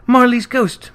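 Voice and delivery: a flame's sudden cry of recognition; cried out. A narrator's reading. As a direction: call it out like a flame crying. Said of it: "as though it cried".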